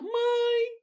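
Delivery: put-on high, tearful little girl pleading; almost crying. Girl swears to her mother the song is hers, high and tearful. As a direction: whimper it like a wronged child near tears. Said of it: "E eu quase chorando".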